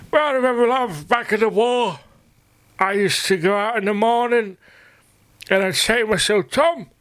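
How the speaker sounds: put-on high, frail old war veteran reminiscing; old soldier voice. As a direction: play a doddering veteran boasting to love. Spoofing old soldier's war memories.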